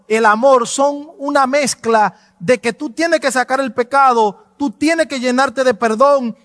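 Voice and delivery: ordinary delivery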